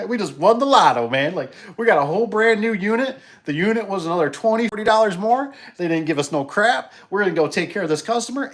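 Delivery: high, loud, giddy telling of the win; excited. Retold or imitated. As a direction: crow like you just won the lotto. Triumphant retelling of the win.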